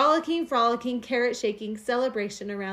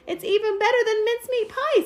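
higher, louder delighted gush; happy delight; 11 semitones higher, 5 dB louder than usual. A narrator's reading. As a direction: savour it like a treat. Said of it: "with a happy sigh".

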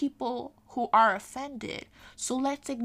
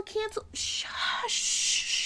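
drawn-out whispered shush; mock shushing; 11 semitones higher, much slower than usual. Mimicking people who wave off cancel culture, mock shushing. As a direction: hush them with a long, exaggerated shhh.

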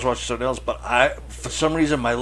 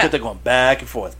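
usual speaking voice

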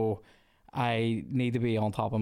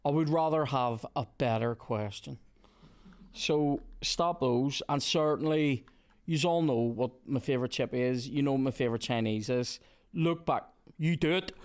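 usual speaking voice

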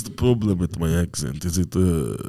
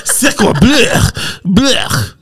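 a much higher, louder hammed-up French accent; 9 dB louder, much slower than usual. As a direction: spit out a mock French Blech in a French accent. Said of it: "no, no, you do one".

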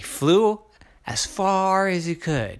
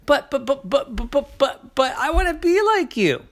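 much higher, pleading but-but-but; stammering crow; 10 semitones higher than usual. Acted. Ike begging the hawks, high stammering. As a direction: stammer out a desperate plea.

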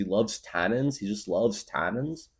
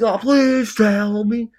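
mock plea; 13 semitones higher, 9 dB louder, much slower than usual. Mock pleading retold.

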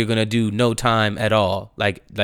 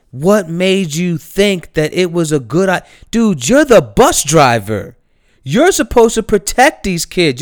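loud, higher scolding rant; angry rant; 4 semitones higher, 4 dB louder than usual. Real, not acted. Vlogger scolding the bus driver about his job, loud and angry. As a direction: lecture him hard on his job.

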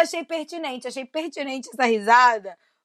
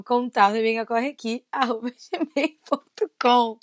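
usual speaking voice

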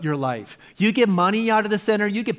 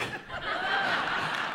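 breathy laugh; 11 dB quieter than usual. Real, not acted. Laughs at his own conversion.

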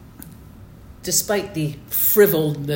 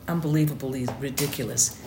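ordinary talk

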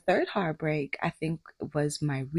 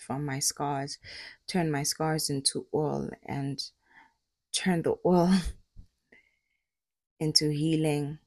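prayer; 3 dB quieter than usual. Real, not acted. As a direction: pray quietly and sincerely. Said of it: "I'm just gonna pray".

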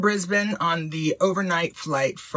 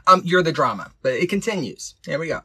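usual speaking voice

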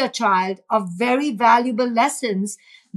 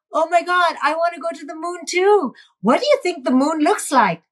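put-on higher, excited voice joining the child's game; enthusiastic playful parent; 7 semitones higher than usual. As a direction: gush with wonder like a delighted parent. Parent joining child's moon wish.

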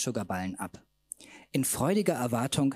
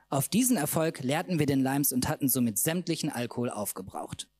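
usual speaking voice

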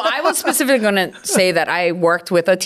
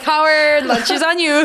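laughing mock quote; 8 semitones higher, slower than usual. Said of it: "Don't be like".

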